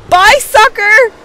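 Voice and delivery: high, loud taunt; taunting shout. Real, not acted. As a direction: jeer as you leave. Taunting as he drives off.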